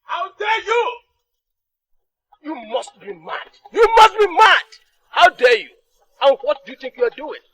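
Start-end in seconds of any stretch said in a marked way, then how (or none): 0.0-1.0 furious high outburst; outraged. Acted. King outraged at son. 3.7-5.7 loud furious royal shout. Acted. King outraged at son.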